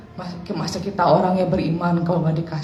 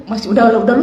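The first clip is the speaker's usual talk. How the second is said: usual speaking voice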